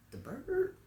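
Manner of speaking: soft, very high questioning rise; puzzled disbelief. Retold or imitated. Teacher questioning a child's crow news, puzzled.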